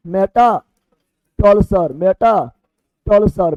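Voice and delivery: मेटाटोलसर stretched out very slowly, syllable by syllable; slow pronunciation drill. Shown as a demonstration. Teacher spelling out मेटाटोलसर slowly for students. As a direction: draw out मेटाटोलसर slowly so the class can copy it. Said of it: "उधर लिखा है ऐसे English में".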